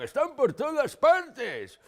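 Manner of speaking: high, loud, drawn-out yell; slow, hammed-up warning cry; mock-alarm shout. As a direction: stretch it out in comic panic. Mock alarm over ubiquitous cider.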